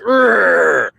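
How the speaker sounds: imitated growl of rage, drawn out; mock rage growl. Mocking a triggered caller, mock-angry growl. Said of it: "sound so triggered and mad and rage".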